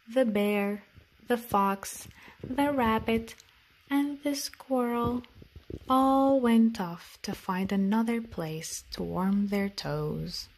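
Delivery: plain reading voice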